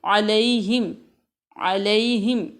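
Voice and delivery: अलैहिम with a clear ऐ glide, slow and careful; correct pronunciation. Shown as a demonstration. A teacher models the correct अलैहिम for students. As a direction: draw out the ऐ in अलैहिम slowly. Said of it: "बल्कि अलैहिम".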